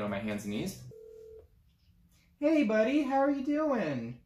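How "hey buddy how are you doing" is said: high, drawn-out sweet-talk; butter up a stranger in a raised voice; put-on friendly singsong; wheedling greeting. Acts out begging a passerby.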